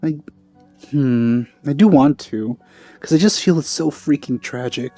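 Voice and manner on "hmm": ordinary delivery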